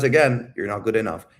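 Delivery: ordinary delivery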